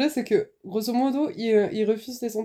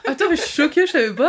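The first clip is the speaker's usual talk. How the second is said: shocked and laughing; surprised; 6 semitones higher, 5 dB louder than usual. Real, not acted. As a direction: laugh through real disbelief. Shocked by shared birthday news.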